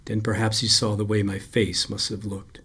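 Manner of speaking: ordinary delivery, nothing marked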